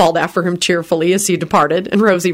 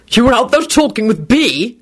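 snapped; 6 semitones higher than usual. A narrator's reading. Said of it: "Quentin snapped".

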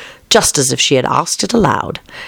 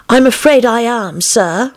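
lightly voiced, higher little-girl Alice; polite young Alice; 9 semitones higher, slower than usual. A narrator's reading. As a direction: answer politely in a raised voice. Alice answers caterpillar in story.

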